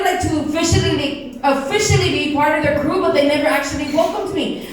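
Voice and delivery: normal talking voice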